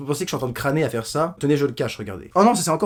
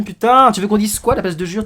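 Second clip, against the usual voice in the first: exasperated outburst; 6 semitones higher, 7 dB louder than usual. Real, not acted. Exasperated at Roman's complaint.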